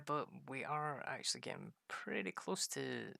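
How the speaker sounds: normal talking voice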